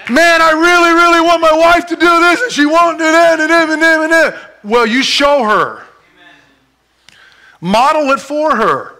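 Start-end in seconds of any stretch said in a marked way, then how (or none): 0.1-4.3 high, loud complaining husband; whiny husband. Acted. Husband griping about his wife, high and whiny. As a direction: whine like a frustrated husband. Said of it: "a husband complaining".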